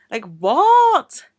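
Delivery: high, loud disbelieving "what"; exasperated, drawn-up exclamation; incredulous. Real, not acted. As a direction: burst out in sheer disbelief. Incredulous at people claiming OCD.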